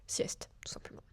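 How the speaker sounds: softly whispered, a joking aside. Real, not acted. To the viewer about the café's Sunday nap, whispered. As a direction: drop to a whisper for the punchline.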